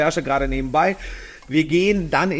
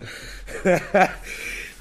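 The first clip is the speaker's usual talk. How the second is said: breathy laugh; 4 semitones higher than usual. Real, not acted. Joke about shirtless in groups.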